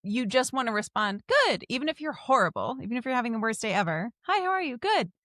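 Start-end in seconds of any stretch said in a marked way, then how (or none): 1.3-1.6 high, louder chirp; automatic cheery good. Acted. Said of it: "saying good in that, like, "Huh," kind of voice". 4.2-5.1 high-pitched chirpy small talk; automatic cheery exchange. Acted. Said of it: "saying good in that, like, "Huh," kind of voice".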